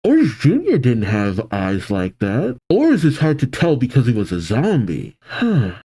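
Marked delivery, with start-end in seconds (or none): none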